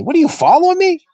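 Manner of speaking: a hint of a wary coach teasing; suspicious coach. Coach voiced in retold story.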